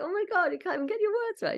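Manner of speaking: high-pitched, amused teasing through laughter; laughing his head off. Acted. Little Alec teasing the speaker about mixed-up words, laughing. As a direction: tease in a high voice, cracking up. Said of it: "he's laughing his head off".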